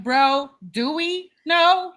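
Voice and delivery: slow, drawn-out mock disbelief; sarcastic retort. Mocking [name]'s self-introduction, slow and sarcastic. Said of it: "I'm like".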